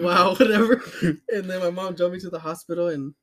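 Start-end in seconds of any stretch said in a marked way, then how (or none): 0.0-0.8 laughing scoff. Retold or imitated. Scoffing at dad who stayed.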